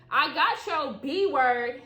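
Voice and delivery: slow, loud teasing threat; playful threat. Acted. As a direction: draw it out, teasing.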